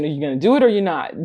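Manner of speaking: ordinary delivery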